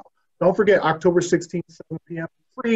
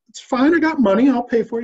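protesting, pitched higher; rope protesting; 6 semitones higher than usual. Acted. Rope pleads with bartender, pitched higher. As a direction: insist you can pay, voice up high. Rope insists he has money.

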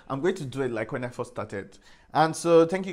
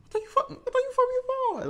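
mock shriek; much faster than usual. Mocking influencers who farm people.

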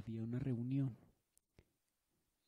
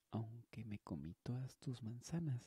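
ordinary talk, nothing marked

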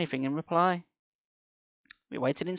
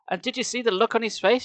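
put-on demanding girl; 7 semitones higher than usual. A narrator's reading. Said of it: "she demanded".

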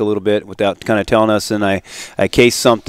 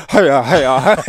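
woozy fainting noise; 3 semitones higher than usual. Retold or imitated. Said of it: "He's like".

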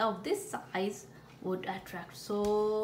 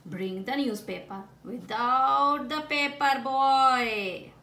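owner's command; 3 semitones higher, 8 dB louder than usual. Acted. Commanding dog.

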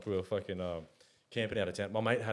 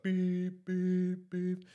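high beeps like a car horn; three high horn beeps; horn imitation; 9 semitones higher, much slower than usual. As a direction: beep out the horn in rhythm. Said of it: "it was like".

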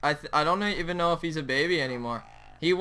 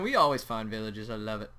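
ordinary talk, nothing marked

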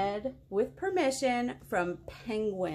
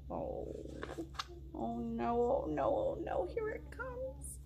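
dread; 5 semitones higher, 8 dB quieter than usual. Acted. Dreading the silly noises.

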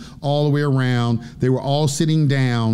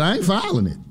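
higher-pitched retold judge's refusal; indignant refusal; 6 semitones higher than usual. Judge refusing once more to file papers, indignant.

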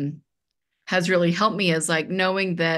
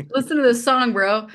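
higher and louder, hamming up the bro; playful emphatic bro; 5 semitones higher, 4 dB louder than usual. Retold or imitated. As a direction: call it out to your son like a buddy. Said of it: "We call, we call each other bro".

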